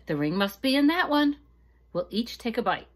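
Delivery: rally everyone for the last one; eager excitement. Acted. Maria over the last tamale, excited.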